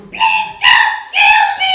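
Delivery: very high, very loud squealing plea; terrified creature plea. Acted. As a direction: beg for its life in a shrill creature voice. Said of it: "It goes".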